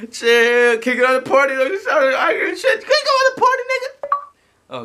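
frantic shouting. Acted. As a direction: voice climbing into a high scream. Screaming to remove the rage quitter.

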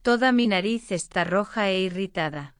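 usual speaking voice

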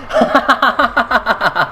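long staccato cackle; helpless run of laughter; rapid laugh. Real, not acted. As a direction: burst into rapid-fire laughter. Startled, then amused.

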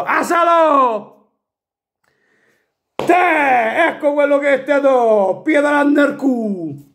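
slow gloating yell; taunting shout. Real, not acted. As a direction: jeer at the rivals. Fan taunts rival team.

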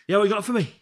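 high, loud eager boss impression. Head chef demanding dishes from the cook, loud and high. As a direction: bark it out like a hungry big character. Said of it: "this is what he's like".

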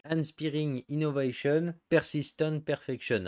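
slow English with a thick French accent; mangled English accent. Real, not acted. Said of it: "mon accent anglais, encore une fois, il est pulvérisé". (accent anglais massacré, à la française)

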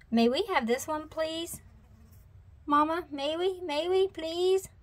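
put-on high child voice begging; pleading little cub. A narrator's reading. Sister asking Mama for the puppy, high pleading.

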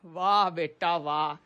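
low, slow, soft mock applause; sarcastic praise. Teacher to cheeky boy, sarcastic praise. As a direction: drag out the praise drily.

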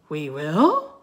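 loud, rising disbelief; startled question. A narrator's reading. Boy questions the girl's offer, startled. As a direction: echo it as an incredulous question.

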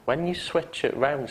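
ordinary talk

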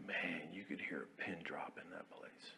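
whispered; hushed whisper. Retold or imitated. Narrating the silent staff room, in a whisper. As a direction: hush it like the room went dead silent.

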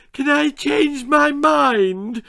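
put-on high, slow, tearful little girl; sobbing little girl. A narrator's reading. Scared little Lizzie begging to stop, sobbing. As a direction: plead through tears. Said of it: "she sobbed".

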